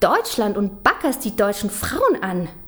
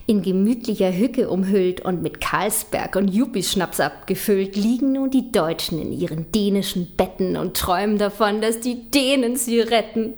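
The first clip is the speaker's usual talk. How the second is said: normal talking voice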